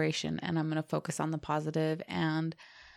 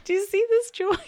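excited; 7 dB louder than usual. Real, not acted. Bursting with joy.

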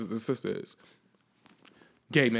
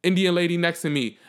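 usual speaking voice

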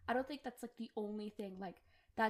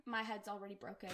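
usual speaking voice

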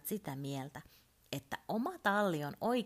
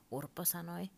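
ordinary delivery, nothing marked

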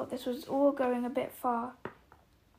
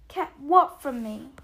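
louder, eager question; 9 dB louder than usual. A narrator's reading. Boy presses to learn what was kept secret, eagerly. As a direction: jump in keen to know. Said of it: "said Harry eagerly".

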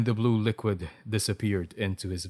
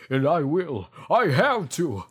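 higher, louder melodramatic resolve; impassioned insistence; 6 semitones higher, 6 dB louder than usual. A narrator's reading. Doctor insisting to Alexa, impassioned. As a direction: insist with hammed-up heroism.

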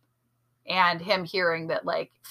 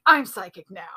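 loud, put-on mocking voice; mocking imitation; 7 dB louder than usual. Imitating a sidekick mocking his psychic partner, loudly teasing. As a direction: mimic him to make fun of him. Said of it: "he was mocking Sean".

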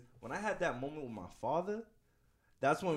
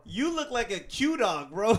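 teasing; 8 semitones higher, 9 dB louder, much slower than usual. Real, not acted. Teasing slow loud jab.

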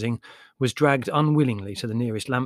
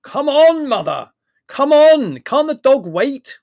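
big, high, loud bellow across the quay; young man bellowing from a cab; 12 dB louder, slower than usual. A narrator's reading. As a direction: yell it over the crowd at mother.

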